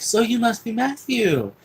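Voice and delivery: high-pitched, gushing welcome; excited greeting. Retold or imitated. Woman meeting the boy she will take in, excited greeting. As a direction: imitate a woman delighted to meet a kid.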